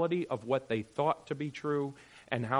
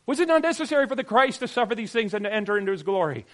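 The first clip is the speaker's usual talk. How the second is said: loud, fast, high insistence; Jesus pressing the disciples, fast and loud; Jesus urging; 10 semitones higher, 9 dB louder, faster than usual. Acted. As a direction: press the question home. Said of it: "he says".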